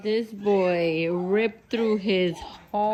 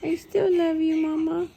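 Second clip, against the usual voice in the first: higher, sing-song pet talk; 7 semitones higher than usual. Real, not acted. Mom reassuring her sulking dog, higher cooing baby talk. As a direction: coo it up high at the dog.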